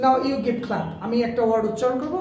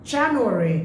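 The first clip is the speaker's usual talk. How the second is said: January stressed on JAN, said louder; right stress; 4 dB louder, much slower than usual. Shown as a demonstration. Teacher repeating correct January to students, first syllable loud. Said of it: "That's the right pronunciation".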